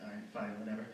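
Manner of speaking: low, very soft reluctant agreement; quietly resigned to a dare; grudging give-in. Acted. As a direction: give in under your breath. Grudgingly gives in to buddy.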